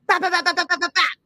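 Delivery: a string of little mock gunshots; imitated gunshots. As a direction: rattle off the shots like a mock gun. Imitating gunshots heard at night.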